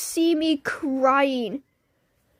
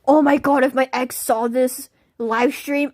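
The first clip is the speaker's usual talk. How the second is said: normal talking voice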